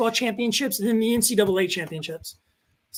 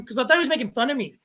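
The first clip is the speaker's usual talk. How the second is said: ordinary talk, nothing marked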